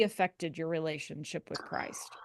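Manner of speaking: drops to a whisper on the question. Real, not acted. Host whispers her question to the guest. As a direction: breathe the question out softly.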